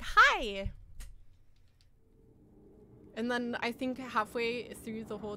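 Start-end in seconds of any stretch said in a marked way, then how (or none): 0.0-0.7 loud. Retold or imitated. Imitating the spooky hole greeting.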